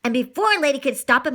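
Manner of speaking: ordinary delivery, nothing marked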